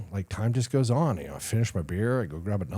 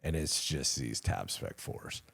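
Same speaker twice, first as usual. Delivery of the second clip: normal talking voice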